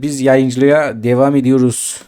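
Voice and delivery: ordinary talk, nothing marked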